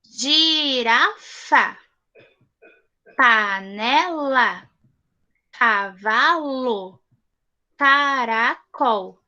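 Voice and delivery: "Girafa", "panela", "cavalo", "caracol" drawn out very slowly, each syllable apart. Shown as a demonstration. Teacher slowly sounding out example words for children. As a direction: stretch out every syllable for the class. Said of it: "nós temos aqui alguns modelos".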